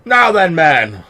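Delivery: high, loud, slow and haughty; pompous captain. Acted. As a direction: boom down at the men like a vain officer.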